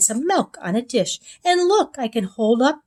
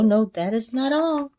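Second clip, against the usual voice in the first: plain reading voice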